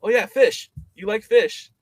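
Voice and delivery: high, loud, beaming insistence; eager interpreter. Retold or imitated. Interpreter urging the guest to eat fish, high and loud. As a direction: push the dish on him cheerfully. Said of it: "He's like".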